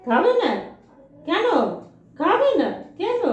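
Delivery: high, loud, slowed pleading; high drawn-out pet pleading; pleading with dog. Real, not acted. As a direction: ask the dog why it won't eat.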